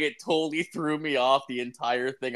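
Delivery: ordinary talk, nothing marked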